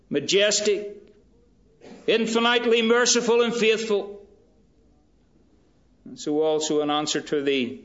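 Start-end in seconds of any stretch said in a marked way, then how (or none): 0.1-4.3 high, loud climax of praise; voicing God. Preacher voicing God's glory to Moses, loud and high. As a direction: build to a ringing peak.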